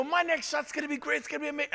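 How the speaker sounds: husband mocking wife's golf pep talk, teasing; mocking imitation. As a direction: make fun of her optimism. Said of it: "I was making fun of her".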